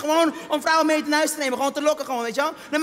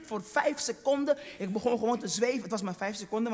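ordinary talk

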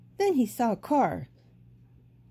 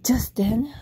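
usual speaking voice

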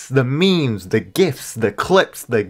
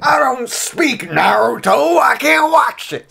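high, slow crying yell; whiny shout; 13 semitones higher, 4 dB louder, slower than usual. Acted. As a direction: wail it like a frustrated fan. Frustrated fan's acted outcry.

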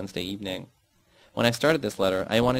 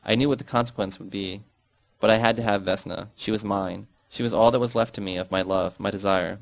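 ordinary delivery, nothing marked

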